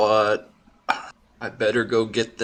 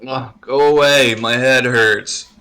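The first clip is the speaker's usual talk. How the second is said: hungover groan; 3 semitones higher, 7 dB louder than usual. Acted. Hungover character groans at knock.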